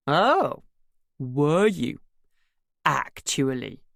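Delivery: hammed-up upper-class English accent, drawn out; mock the neighbour's cut-glass English accent. A narrator's reading. Drunk son mocking the neighbour in a cut-glass English accent. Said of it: "excruciating imitation of her cut glass accent".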